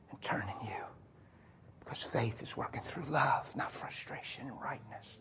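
very soft, low, near-whispered assurance; hushed preaching. Real, not acted. Preacher assuring congregation of the Spirit's work, hushed low voice. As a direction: drop almost to a whisper, low and tender.

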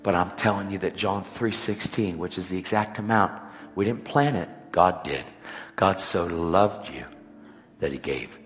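gently reassuring a hurting waitress; tender and sincere. Retold or imitated. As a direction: comfort her gently.

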